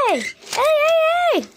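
high urgent calls; calling out. Pretend. Toy calling out to the buses, high shout. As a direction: shout hey to stop them.

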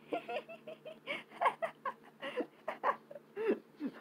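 high squeaky cartoon cackle; cartoon laugh. Real, not acted. Gleeful win laugh.

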